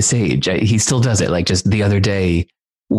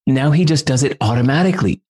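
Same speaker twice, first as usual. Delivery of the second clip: ordinary talk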